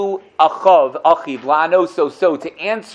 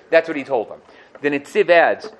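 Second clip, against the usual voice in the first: ordinary talk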